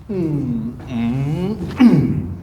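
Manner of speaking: drawn-out hums, last one punched; wordless hummed swing cue; rhythmic swing hum. Shown as a demonstration. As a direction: hum the swing's effort, rising into a burst. Coach voices swing rhythm.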